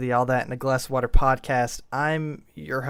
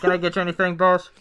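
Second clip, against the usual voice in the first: louder, higher drive-thru worker; nervous fast food employee talking to boss; drive thru speaker; 6 semitones higher, 5 dB louder, faster than usual. Acted. Plays employee Michael.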